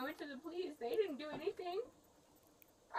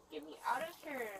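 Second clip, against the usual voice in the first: ordinary delivery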